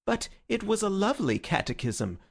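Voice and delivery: high, plaintive mock hurt; pathetic. Syme pleading with friends about his catechism, plaintively high. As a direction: whine it like a wounded child. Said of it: "said Syme pathetically".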